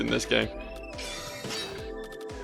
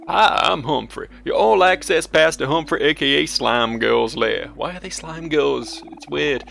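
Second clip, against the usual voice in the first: cheerful elevator voice; 3 semitones higher than usual. Acted. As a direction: play a chirpy talking elevator. Reads Humphrey elevator dialogue.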